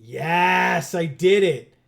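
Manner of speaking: loud, high victory cry; triumphant. Real, not acted. Player beating a very hard level, triumphant. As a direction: shout it out like a real win.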